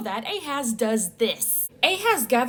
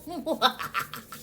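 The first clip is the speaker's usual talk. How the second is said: short amused laugh; 5 semitones higher, 4 dB quieter than usual. Real, not acted. Laughing at own impression.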